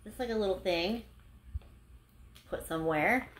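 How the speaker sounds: ordinary talk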